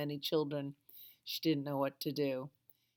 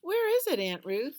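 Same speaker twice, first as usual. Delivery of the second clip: very high, loud astonished question; surprised; 10 semitones higher, 10 dB louder than usual. A narrator's reading. Rosie asking Aunt Ruth where her rose is, surprised. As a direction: ask it in disbelief. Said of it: "asked Rosie in surprise".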